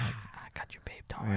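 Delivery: hushed, tender coo; soft cooing girlfriend voice. Retold or imitated. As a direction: soothe him like a baby, very softly.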